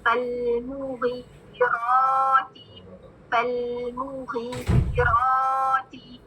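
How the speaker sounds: फलमुगीराती recited very slowly; slow recitation demo. Shown as a demonstration. Teacher repeats word before practice. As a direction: stretch फलमुगीराती for students to copy. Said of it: "सब practice करें इस word की".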